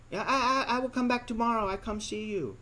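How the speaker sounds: high, fast put-on girl's voice; mock bar girl. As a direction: rattle off her empty promise. Mimicking the girl's promise.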